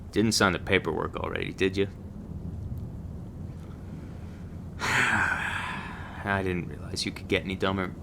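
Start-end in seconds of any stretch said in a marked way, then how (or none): 1.9-4.7 long, quiet exasperated exhale; drawn-out breath of exasperation; weary sigh. Acted. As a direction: sigh out slowly, fed up. Trainer exasperated with the rookie.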